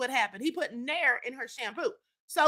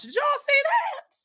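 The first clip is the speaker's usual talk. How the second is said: much higher, slow, incredulous shout; shrieking disbelief; 12 semitones higher, slower than usual. Real, not acted. Host asking viewers if they saw the photo, shrieking in disbelief. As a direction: squeal it out in disbelief.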